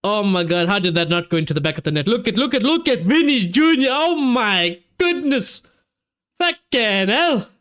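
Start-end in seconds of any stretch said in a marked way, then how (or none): 2.1-7.5 excited disbelief. Real, not acted. Reacting to Vinicius chance.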